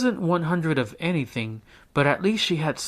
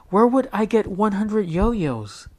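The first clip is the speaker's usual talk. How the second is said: put-on high, scoffing girl voice; exasperated girl; 8 semitones higher, 4 dB louder than usual. A narrator's reading. Jessica to her brother Tom: high exasperated girl voice. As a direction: brush off a silly idea.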